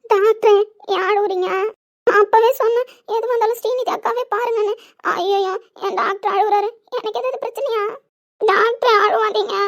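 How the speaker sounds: put-on childlike crying.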